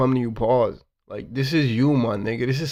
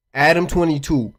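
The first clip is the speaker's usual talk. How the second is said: ordinary talk, nothing marked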